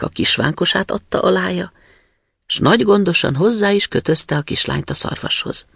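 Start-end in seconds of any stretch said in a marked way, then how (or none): none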